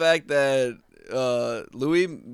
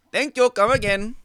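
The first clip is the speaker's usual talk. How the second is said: mock Apu accent; 7 semitones higher, 3 dB louder than usual. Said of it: "you use this kind of accent".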